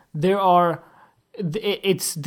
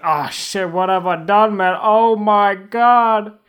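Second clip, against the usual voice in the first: mock regret; 3 semitones higher, 8 dB louder, slower than usual. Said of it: "he's like".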